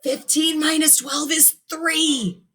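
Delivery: high, slow hushed prompt; Frankie prompting. A narrator's reading. Frankie prompting his friend in class, high and hushed.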